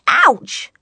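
sharp high yelp of pain; ouch. Acted. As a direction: yelp at the pinch. Walked into crabs.

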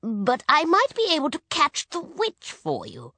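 high-pitched wizard, dropping at the end; old wizard. A narrator's reading. Wizard offering to catch the witch, old wizard voice. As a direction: offer help in a quirky wizard voice.

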